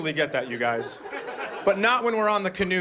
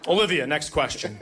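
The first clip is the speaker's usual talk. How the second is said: ordinary talk, nothing marked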